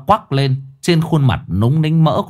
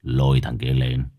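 low, quiet, menacing command; a gang boss barking a cold order; 9 semitones lower, 4 dB quieter than usual. Acted. Boss orders the beating victim brought.

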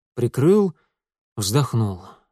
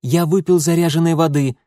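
higher, louder cut-in; quick defensive interjection; interrupting; 5 semitones higher, 6 dB louder than usual. A narrator's reading. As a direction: break in sharply over the other speaker. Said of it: "перебил его Митя".

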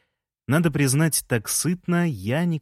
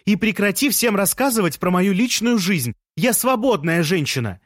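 high, raised telling-off; heated, higher-pitched demand; indignant shouting; 8 semitones higher than usual. A narrator's reading. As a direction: shout back in protest. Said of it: "закричала Лея".

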